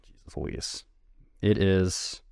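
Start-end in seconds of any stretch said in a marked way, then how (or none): 0.0-0.8 whispered under the breath, much softer; whispered exasperation. Real, not acted. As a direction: mutter it to yourself in frustration. Exasperated at blanking on title.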